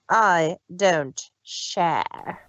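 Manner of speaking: cold. Acted. Villain's cold final line.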